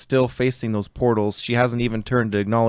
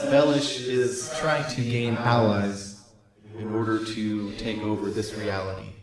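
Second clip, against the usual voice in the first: sorceress voice; 4 dB quieter than usual. Acted. GM voices Helena.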